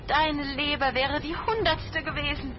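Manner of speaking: high-pitched cursing cry. A narrator's reading. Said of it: "Er hörte die Schwester rufen". (hoher, verfluchender Aufschrei)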